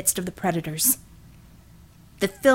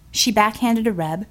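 ordinary delivery

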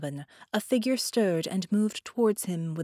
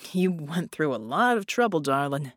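groaning; 2 semitones lower than usual. Acted. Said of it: "Red groaned".

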